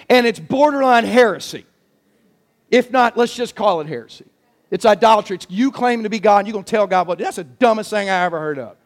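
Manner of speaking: normal talking voice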